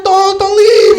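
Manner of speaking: high, loud desperate plea; panicked begging. Retold or imitated. Handcuffed man begging girlfriend not to leave, panicked shout. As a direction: beg her to stay, panicking.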